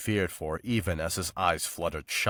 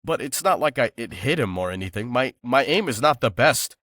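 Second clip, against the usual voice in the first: justifying; 5 semitones higher, 6 dB louder than usual. A narrator's reading. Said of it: "she tried to justify".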